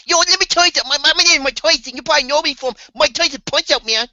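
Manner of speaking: high, fast lisping boxer voice; goofy high Mike Tyson parody; Mike Tyson impression. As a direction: do a squeaky Mike Tyson bragging. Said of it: "Mike Tyson's in this movie".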